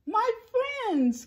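very high, relieved cry; high-pitched emotional outcry. A narrator's reading. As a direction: call out joyfully to lost friends. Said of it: "cried the elephant".

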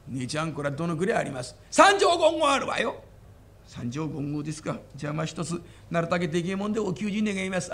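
a shy hulking rustic asking politely; timid big bumpkin. Acted. Big man asks maid.

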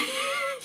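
short high-pitched giggle burst; high laugh. Real, not acted. Storyteller amused by her captive's plea, high giggle. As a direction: let a quick high laugh slip out.

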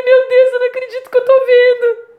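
high, teary voice of disbelief; tearful amazement. Retold or imitated. Crying on the mountain at the glowing leaves, tearful awe. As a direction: gasp it out through happy tears. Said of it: "Aí eu comecei a chorar, falei".

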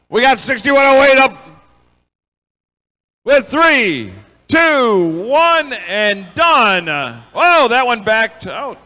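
rising excited play-by-play call; live play-by-play. Real, not acted.

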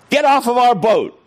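high, forceful order; retold firm command.

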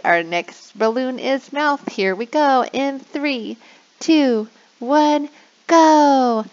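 put-on game show countdown to kids; excited countdown. Shown as a demonstration. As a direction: hype the kids up for the start.

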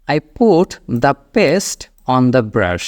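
very slow, each word clear; slow model sentence. Shown as a demonstration. Teacher showing learners what to say at the sink, very slow. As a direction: lay it out slowly to be repeated. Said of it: "তাহলে কেমন হবে?".

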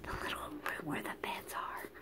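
fast hushed whisper. Real, not acted. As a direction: keep it down so the sleepers don't wake. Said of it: "not gonna say that real loosey really loud".

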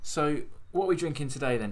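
ordinary talk, nothing marked

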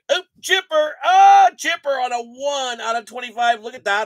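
thrilled. Real, not acted. Card breaker hypes a rare pull to viewers, thrilled and loud. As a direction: shout the card out like a big win.

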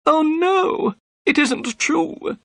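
very high, distressed wail; dismayed accused man crying out; crying out in protest. A narrator's reading. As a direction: plead innocence in a thin high voice. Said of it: "cried Thaddeus Sholto".

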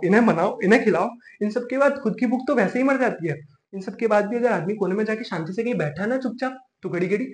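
ordinary talk

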